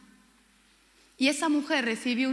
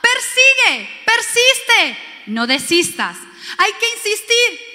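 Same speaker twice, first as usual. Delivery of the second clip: loud, high preaching at full force; fiery preaching; 7 semitones higher, 7 dB louder than usual. Acted. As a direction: proclaim it to the congregation. (predicación a todo pulmón, alta y fuerte)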